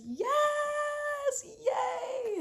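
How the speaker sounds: high, gleeful squeal; squealing excitement. Retold or imitated. Imitating Maria's excited yes, squealed high. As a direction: shriek yes like the bride. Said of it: "she says".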